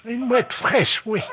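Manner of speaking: high, pleased granny; old lady voice. Pretend. Old lady with her mouth full.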